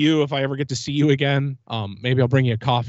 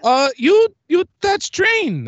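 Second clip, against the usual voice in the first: much higher, slower put-on voice of a stern ride attendant; imitating the lady; 13 semitones higher, 4 dB louder, much slower than usual. Recounting how the attendant at the ride booth called him back, mimicked high and slow. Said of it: "This is how she sounded".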